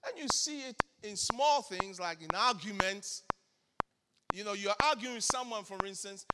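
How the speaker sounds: ordinary talk, nothing marked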